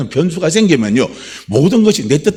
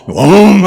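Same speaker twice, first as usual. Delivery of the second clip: 크게 으르렁대는 사자 흉내; 낮게 울리는 포효를 흉내내듯; 3 semitones higher, 7 dB louder, much slower than usual. Retold or imitated. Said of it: "무섭다고 막". (크게 으르렁 흉내)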